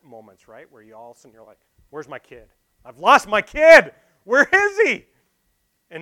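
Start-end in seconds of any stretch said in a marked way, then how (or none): 1.9-5.1 suddenly loud and high, rising to a frantic shout; a parent who just realised the kid is gone; panicked parent. Retold or imitated. Panicked parent losing their child.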